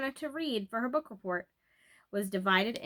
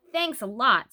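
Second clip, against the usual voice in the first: dry thanks; 7 dB louder, slower than usual. A narrator's reading. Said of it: "not wanting to think of herself as old".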